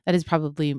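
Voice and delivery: usual speaking voice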